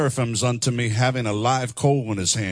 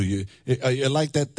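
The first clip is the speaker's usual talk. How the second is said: normal talking voice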